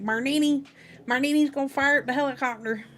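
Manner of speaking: excited, quick Southern twang; Forrest's Alabama twang. A narrator's reading. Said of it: "Excited Forrest's Alabama twang".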